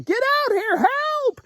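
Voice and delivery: very high-pitched acted holler; hollering for help. Fisherman calling friends to the boat, urgent high holler. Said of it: "start hollering out for their friends".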